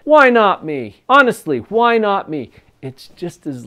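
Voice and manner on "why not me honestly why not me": loud, emphatic challenge to oneself; higher, punchy self-confidence; positive alternative. Shown as a demonstration. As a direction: say it boldly, with conviction. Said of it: "What's the alternative? Practice saying this instead".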